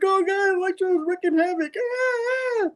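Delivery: very high, crying panicked nerd; panicked scream. Retold or imitated. The nerd flees Electro, shrieking in high panic. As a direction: shriek in terror as Electro attacks.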